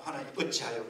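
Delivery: low, soft, dignified question; refined complaint. Shown as a demonstration. Pastor showing a classier way to complain, dignified and hushed. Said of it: "고상하잖아요".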